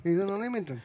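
hint of Italian accent. Co-host teasing about the Italian town, light mock Italian accent. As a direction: toss off the town name in a playful Italian accent. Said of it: "I wish I could do a better accent".

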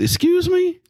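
high, shocked excuse me; mock outrage at no nuts; indignant disbelief. Retold indignant reply to staff.

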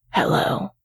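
a light hushed greeting; low creature whisper. A narrator's reading. Creature under the bed greets his friend, whispered. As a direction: whisper a friendly hello from below. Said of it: "he says".

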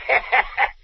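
high villainous chuckle; evil, high-pitched cackle; gloating laugh. Acted. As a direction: laugh in wicked delight. Villain gloats with his creation.

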